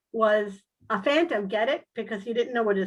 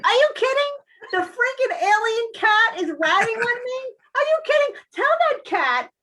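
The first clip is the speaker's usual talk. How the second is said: much higher, loud indignant squawk; outraged disbelief; 12 semitones higher, 5 dB louder than usual. Retold or imitated. Furious at the alien cat for ratting on her, shrill outrage. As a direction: blow up in comic outrage.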